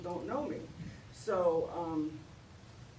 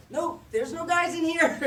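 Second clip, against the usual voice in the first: pitched-up voice; 10 semitones higher, 9 dB louder than usual. Retold or imitated. Hiding in the stall from the security guard, voice pitched up high. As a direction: voice pushed higher and louder to pass as a woman. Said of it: "I pitch my voice up".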